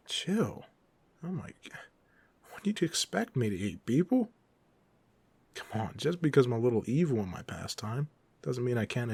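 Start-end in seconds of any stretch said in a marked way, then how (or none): 3.9-4.3 higher, louder incredulous question. Acted. Villain offended by the hero's suspicion, incredulous. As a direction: pitch it up in offended disbelief.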